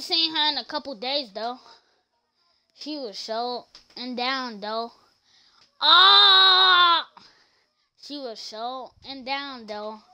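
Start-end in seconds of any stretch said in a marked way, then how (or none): 5.8-7.1 loud, high held scream; long "ah" yelled at high pitch; imitated scream. Retelling a friend's outburst, mock scream.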